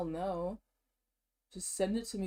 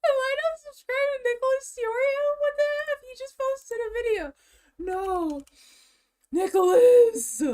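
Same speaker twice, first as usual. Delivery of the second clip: much higher, louder disbelieving squeal; shocked squeal; 8 dB louder than usual. Real, not acted. Vlogger shrieking on finding he is not subscribed.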